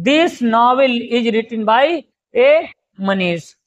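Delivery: very slow, deliberate words; slow dictation. Shown as a demonstration. Teacher giving the class an example sentence, very slow. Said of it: "जैसे".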